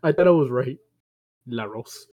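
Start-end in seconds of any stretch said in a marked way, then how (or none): 1.5-2.1 La Ross as "lah rohs", said low and quiet; soft La Ross with a rounded Spanish "o"; Spanish-style pronunciation. Shown as a demonstration. Friend repeating the old habit, La Ross as "lah rohs". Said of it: "I always call it".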